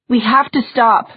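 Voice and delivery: shouted urgently over battle noise; shouting. Acted. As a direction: raise the voice over the fighting. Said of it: "Julian shouted".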